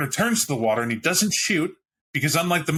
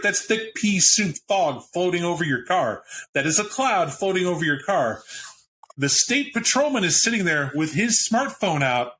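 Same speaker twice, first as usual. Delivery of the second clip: ordinary talk, nothing marked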